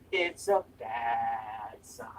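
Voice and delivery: "It's a bad sign" slow, whispered. Shown as a demonstration. Streamer tasting a drink, slow whispered verdict. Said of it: "repeats a phrase".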